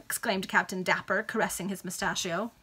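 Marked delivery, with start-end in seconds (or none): none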